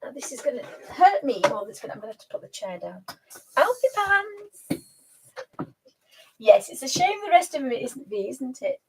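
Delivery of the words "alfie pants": high, long drawn-out pet call. Real, not acted. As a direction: coo the pet's name high and slowly. Calling her pet.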